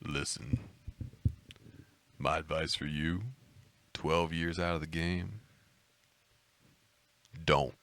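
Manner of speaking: low, soft, deep seductive croon; lightly put-on sexy deep voice.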